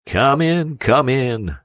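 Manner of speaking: a sailor roaring to the dories. A narrator's reading. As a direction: bellow the welcome across the water. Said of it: "roared Long Jack".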